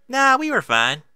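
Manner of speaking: put-on high, loud little-girl voice; bright child brushing it off; lightly voiced young filly. A narrator's reading. Applebloom voiced, child figure.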